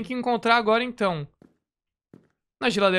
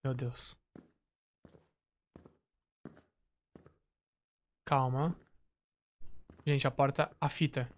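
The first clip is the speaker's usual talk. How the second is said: hushed and much softer, lower pitch; scared hushed; 7 semitones lower, 11 dB quieter than usual. Real, not acted. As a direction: breathe it out, afraid to be heard. Scared creeping toward open door.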